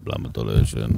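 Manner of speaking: a bass father scolding at night; mock scary father. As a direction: rumble it like a dad catching a kid awake. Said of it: "פתאום בלילה הוא בא לך".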